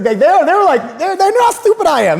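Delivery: high laughing; giggling high over his own story. Real, not acted.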